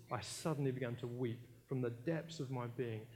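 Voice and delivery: normal talking voice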